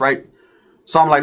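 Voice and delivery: ordinary delivery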